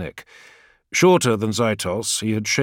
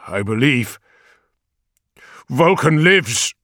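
slow, louder, higher emotional vow; trembling with emotion; 6 semitones higher, 7 dB louder, slower than usual. Acted. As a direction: proclaim it, voice moved. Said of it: "emotion making him tremble".

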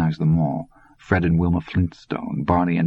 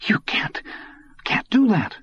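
breathless start, then a high cracking cry; the words break out higher as panic rises; boy stunned into a cracked high plea; stunned, breaking disbelief. A narrator's reading. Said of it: "stunned and unbelieving voice".

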